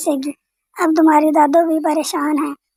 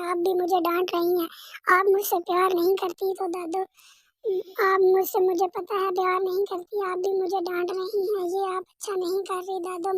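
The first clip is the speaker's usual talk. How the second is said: put-on upset little boy; 3 semitones higher, 10 dB quieter than usual.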